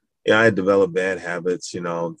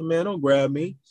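retold warning; 10 semitones higher than usual. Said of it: "I said".

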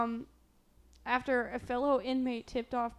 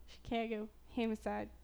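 softer Chicago accent with short-A vowels; 7 dB quieter than usual. Shown as a demonstration. Wondering how locals say it, Chicago accent. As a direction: try out Chicago vowels, she-CAG-o, HAM-icide. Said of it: "How would they say it in Chicago?".

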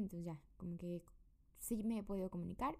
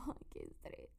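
a whispered sigh of stress; hushed, worn-out complaint; weary whisper; 6 dB quieter than usual. Retold or imitated. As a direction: breathe it out in a tired whisper. Exhausted, can't sleep, sighs.

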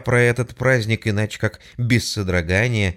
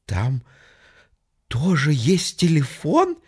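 slow, timid woman's voice; frightened question; 5 semitones higher, slower than usual. Acted. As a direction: ask it scared. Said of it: "испуганно спросил голос". (испуганно)

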